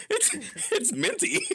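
laughing through the words; words broken by high giggles. Real, not acted. Laughing fit over toothpaste bean.

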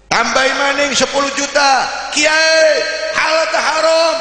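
shouted, high offer and question; loud haggling. Acted. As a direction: yell the bigger bribe. Briber raises the bribe, shouting. (teriak menawar suap)

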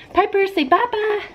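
very high sing-song prompt. Real, not acted. Prompting baby to wave.

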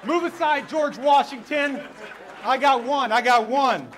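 loud, high triumphant crowing; dad shouting in delight at his daughter's answer; proud excited shout. Real, not acted. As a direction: crow it like you just won.

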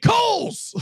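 blurted high shout of a store name; mock shout. The man shouts an answer to the cop's question. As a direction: yell it out like a random answer. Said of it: "he's like".

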